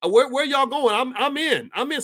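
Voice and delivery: high, eager voice of someone jumping in; playful imitation of eager recruits; eager joiner. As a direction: sound thrilled to join the fight.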